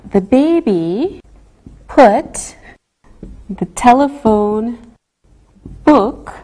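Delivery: each word set apart, unhurried; slow and careful. Shown as a demonstration. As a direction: say it slowly like on the phone. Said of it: "how important it is to speak slowly on the telephone".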